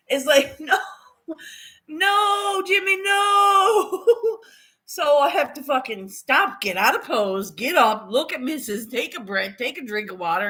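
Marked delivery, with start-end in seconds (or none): 0.6-4.5 high, stretched-out whining cry; mock wail. Stuck in the yoga pose, wailing slowly in mock despair. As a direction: drag out a mock plea for mercy.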